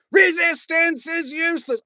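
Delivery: loud dim-witted Vogon guard shout. A narrator's reading. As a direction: bark the stock phrase like a dim brute. Guard repeats stock phrase.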